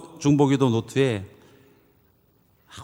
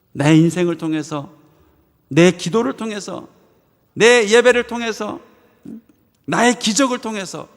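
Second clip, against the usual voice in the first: rising, louder emphatic litany; building preaching; 7 semitones higher, 5 dB louder than usual. Acted. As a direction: climb with each phrase.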